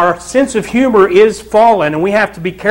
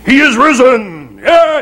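high, raised, booming proclamation; 6 semitones higher than usual. Acted. Preacher parodying resurrection films for his congregation, booming voice. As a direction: boom it out like a mock movie angel. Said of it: "booming voices".

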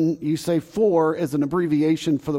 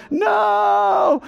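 very high, loud cry of No; desperate shout; 5 dB louder than usual. Retold or imitated. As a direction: yell it as the ball heads for your car.

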